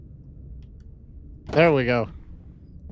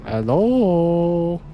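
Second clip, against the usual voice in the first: a louder, long drawn call; 3 semitones higher, 6 dB louder than usual. Pretend. Searching for Sammy, calling out. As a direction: call into the empty room.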